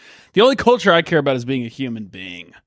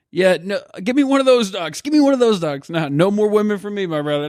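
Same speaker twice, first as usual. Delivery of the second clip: much higher, eager "give me one of those dogs"; a chatty driver spotting a dog, voice jumps up; excited driver voice; 7 semitones higher than usual. Retold or imitated. Driver spots someone walking dogs and asks eagerly in a high voice. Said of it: "he says".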